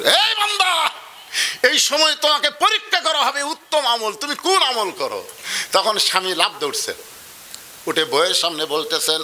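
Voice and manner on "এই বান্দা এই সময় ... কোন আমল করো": preacher calling out to his listeners; preaching call. Acted. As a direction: cry out to the servant.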